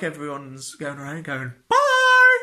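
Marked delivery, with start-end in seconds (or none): 1.7-2.4 very high, loud, stretched-out bye; a cartoonish sing-song farewell; mock singsong bye. Colleagues saying bye mockingly.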